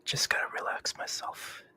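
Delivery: soft breathy whisper; whispering on the phone. Real, not acted. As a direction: whisper it to settle down. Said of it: "Why are you whispering?".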